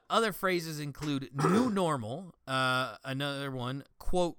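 ordinary delivery

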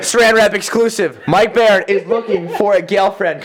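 loud, slow mock news flash; news anchor voice. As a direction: announce it like breaking gossip news. Mock breaking news announcement.

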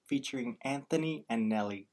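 usual speaking voice

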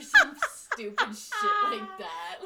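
high, loud laughing line. Real, not acted. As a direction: squeal it while laughing. Laughing after the jingle.

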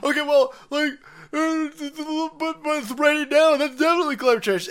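high, loud put-on whine; mock panicky believer. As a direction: play a flustered believer clutching at rain. Mocking climate believer.

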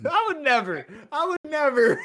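laughing at friend's chili pain; laughing squeal. Real, not acted.

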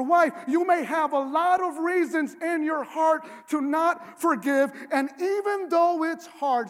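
loud, driving sermon delivery, pressing each point home; fervent preaching. Acted. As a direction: preach it with full conviction to the congregation. Preaches forgiveness at full force.

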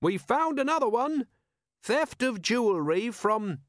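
high, cheery calling voice; bright announcement. A narrator's reading. Captain announcing charges to the trapped robbers, brightly. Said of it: "he said brightly".

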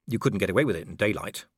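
ordinary delivery

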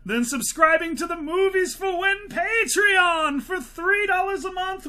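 mock infomercial sales push; hammed-up pitch for the Patreon; hyped ad pitch. Big Patreon plug.

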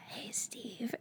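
creepy whispered greeting. Retold or imitated. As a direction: breathe it like a creepy caller. Creepy prank call whisper.

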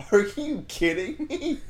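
high, slow disbelief through laughter; laughing mock outrage. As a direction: imitate an outraged coworker while cracking up. Retold outrage, teller laughing.

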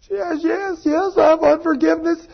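high-pitched imitated woman's voice; acted-out woman's outburst. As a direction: blurt it out high, imitating her. Woman admitting unforgiveness, acted out.

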